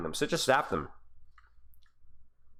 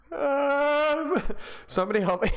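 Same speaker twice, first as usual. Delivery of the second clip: giggly plea; 9 semitones higher, slower than usual. Real, not acted. Laughing at glitching Doomfist foot.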